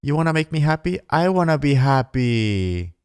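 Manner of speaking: hammed-up dreamy bride-to-be; swooning girl. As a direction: parody a girl melting at sweet promises. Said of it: "And then the girl is like".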